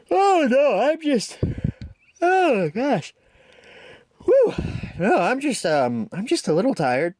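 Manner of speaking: high, stammering nervous denial; flustered Thomas. A narrator's reading. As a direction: bluster and cover up the fear. Flustered Thomas hides fear.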